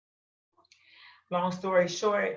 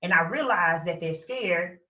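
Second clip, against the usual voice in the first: normal talking voice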